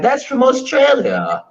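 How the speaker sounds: high, slow mock reaction; retold UK listeners. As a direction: imitate surprised listeners, stretched and high. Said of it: "They were like".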